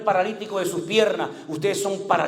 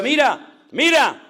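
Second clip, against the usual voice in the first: higher and louder, calling out; urgent call; 8 semitones higher, 6 dB louder, slower than usual. Acted. David to Saul, calling out urgently. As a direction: grab his attention with the cloth. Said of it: "le dice".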